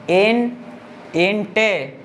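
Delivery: इन, then इंटे, as separate chunks; syllables isolated. Shown as a demonstration. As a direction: sound out the start इंटे piece by piece. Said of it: "एक बार में इतना पढ़ेंगे".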